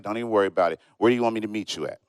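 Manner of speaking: a hint of a laid-back stranger; lightly voiced calm guy.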